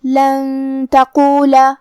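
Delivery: slow لن تقولا with the n hidden in a long hum; ikhfa with ghunnah. Shown as a demonstration. Teacher showing the hidden noon, long nasal hum in لن تقولا. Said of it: "نون کو چھپاتے ہوئے لیکن غنہ کے ساتھ".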